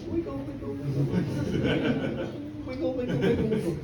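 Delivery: breathy wiggle chant, voice dropping away; magic wiggling. Acted. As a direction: mutter the transforming wiggle. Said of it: "wiggled, like this".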